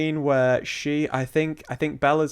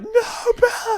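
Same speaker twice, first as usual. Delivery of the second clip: high, loud sobbing cry of her name; crying scream; 6 dB louder, slower than usual. Retold or imitated. Friend playing a desperate onlooker, crying scream. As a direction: scream it in anguish. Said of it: "I'd be like".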